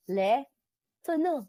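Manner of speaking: Le Tourneau said the French way, tour-NO; imitating the seller saying Le Tourneau; quoting the seller's French Le Tourneau; French brand name. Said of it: "Parang Hermes".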